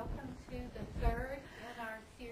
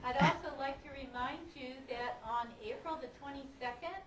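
louder on request; 3 semitones higher, 9 dB louder than usual. Shown as a demonstration. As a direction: repeat it louder for the back row. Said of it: "could we have some more volume".

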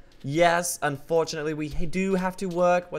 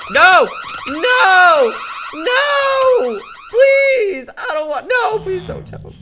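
high, loud shouted pleading; desperate scream; 10 dB louder, much slower than usual. Real, not acted. Begging the game not to catch him, screaming. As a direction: scream and beg in despair.

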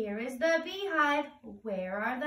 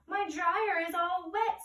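Honeybee voice; 7 semitones higher than usual. Acted.

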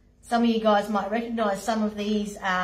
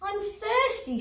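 whiny lion; 11 semitones higher than usual. Acted. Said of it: "said the lion".

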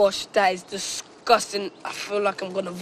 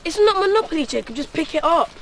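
high, loud scornful snap; mock his complaint loudly; annoyed; 7 semitones higher, 5 dB louder than usual. Annoyed friend refusing.

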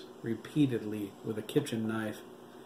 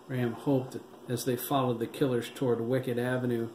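ordinary delivery, nothing marked

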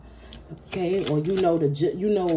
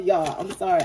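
ordinary talk, nothing marked

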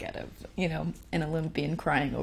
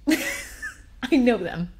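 laughing through the words, very slowly; 6 semitones higher, much slower than usual. Real, not acted. Telling viewers she knew the answers, laughing. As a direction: chuckle as you insist on it. Laughing at her own test fail.

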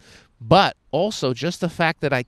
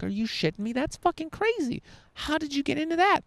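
sudden high squeal of disbelief, softer; amazed disbelief; 13 semitones higher, 3 dB quieter than usual. Pretend. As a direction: gush in shocked amazement. Amazed reaction to a designer.

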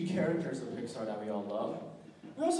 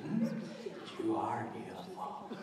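menacing villain; 5 semitones higher than usual. Acted. Cackling villain telling a captive about his scars. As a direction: taunt a victim, giggling madly, then drop to a whisper. Unnamed villain impression, scarred-face monologue.

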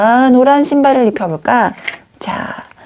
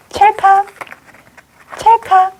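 crisp, much higher spoken "click"; high-pitched click sound effect; 11 semitones higher than usual. Pretend. Teacher fastens the doll's trousers, high playful click. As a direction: voice a little snap-on click, pitched way up.

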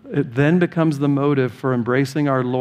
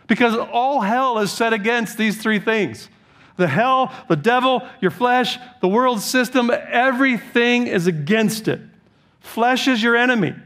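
higher, insistent preacher's warning; rousing preaching; 9 semitones higher than usual. Acted. Pastor warning his flock of the enemy, rousing preaching. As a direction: sound the alarm from the pulpit. Warning the congregation against the flesh.

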